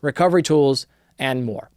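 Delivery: brisk sponsor read, a bit pushed up. Acted. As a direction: sell it like a podcast ad spot. Host reads sponsor ad.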